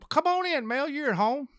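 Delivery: high-pitched welcome at the door; sing-out invitation; welcoming hostess. Acted. Hostess welcomes visitor in joke.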